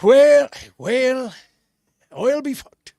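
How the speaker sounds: high, loud hammed-up Irish accent. Host repeats the phrase in Irish accent. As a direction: go big on the Irish brogue. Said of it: "Let's hear it one more time".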